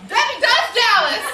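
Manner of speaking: loud, high, drawn-out shout; imitating an eager player's winning yell; triumphant game answer. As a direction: blurt it out proudly at full volume. Acted triumphant answer in word game.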